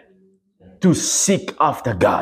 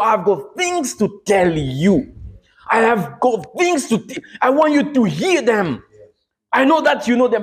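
high, emphatic pleading with God; insistent prayer; 6 semitones higher than usual. Retold or imitated. Man announcing himself to God by middle name, pleading insistently. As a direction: press each request upward, urgent and insistent. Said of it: "I tell God my middle name".

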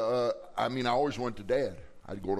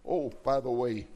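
normal talking voice

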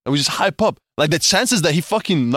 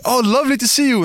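mock polite; 9 semitones higher than usual.

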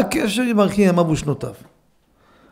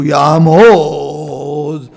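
loud, very slow ceremonial call; drawn-out summons; 3 semitones lower, 7 dB louder, much slower than usual. Acted. Gabbai calls a prepared reader to stand, loud and drawn out. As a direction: stretch it long like a synagogue call.

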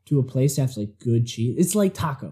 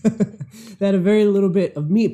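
laughing; 6 semitones higher, 4 dB louder than usual. Real, not acted. Laughing through the line.

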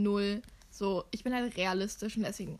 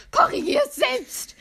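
shrill furious shout, dragged slow; loud, slow, furious yell; angry yell; 14 semitones higher, 12 dB louder than usual. Retold or imitated. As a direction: scream it at her like you've had enough. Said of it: "ich war so aggressiv".

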